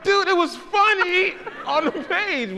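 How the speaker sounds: high, loud plea through laughter; laughing through the excuse; laughing protest. Real, not acted. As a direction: defend it while cracking up. Laughing, defending the bombed sketch.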